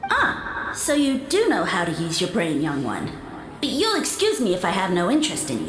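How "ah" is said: breathy high exclamation. Acted. Mushroom lord pleased at being found out.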